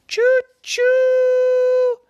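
high loud choo-choo whistle; train whistle. A narrator's reading. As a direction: hoot it like a toy train. Frog turns into train.

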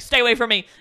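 loud threatening imitation; mock threat. Man wielding a cane at hospital staff, mock threatening shout. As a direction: warn them off at full volume, playfully acted. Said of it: "he's threatening the hospital staff".

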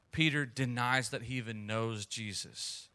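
ordinary talk, nothing marked

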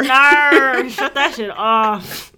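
loud, drawn-out mock demand; mock protest. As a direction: order it off in exaggerated disgust. Retold reaction to British accent.

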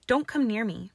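high, loud alarmed warning; cornered and alarmed. Acted. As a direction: keep him back, rattled but fierce. Cornered against the wall.

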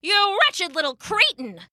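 put-on high, venomous insult from an old crone; spitting anger. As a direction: spit the words out in contempt. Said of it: "the old woman spat".

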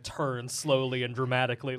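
ordinary talk

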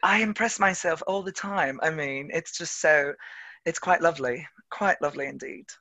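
put-on playful, refined British accent. Actor impressing themselves with dialects, put-on British accent. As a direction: savour it like a pleased Brit. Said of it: "like, a French accent or a British accent".

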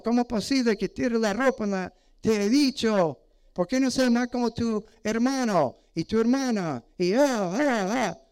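hammed-up nagging mom; nagging parent. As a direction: rattle off complaints at a child like a fed-up mother. Parent nagging child, mocked.

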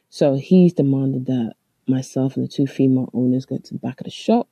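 ordinary delivery

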